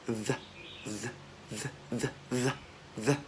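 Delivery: "the" as a short isolated buzzing TH; voiced TH demo. Shown as a demonstration. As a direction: softly sound out "the", one at a time. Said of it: "vẫn là âm T H".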